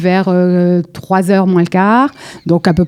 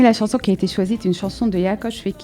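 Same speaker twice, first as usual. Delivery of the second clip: faint off-mic voice; barely audible, away from mic; far from mic; 8 dB quieter than usual. Real, not acted. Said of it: "On vous entend pas du tout".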